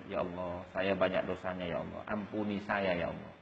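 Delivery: hushed, low plea for forgiveness; private prayer. Acted. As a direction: pray softly and low, alone with God. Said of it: "dikala kita sendiri dengan Allah, kita mikir". (doa lirih seorang diri)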